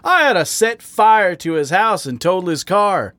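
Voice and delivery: worked-up, heated complaint; angry rant. A narrator's reading. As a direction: rant it with rising outrage. Growled rant carries on.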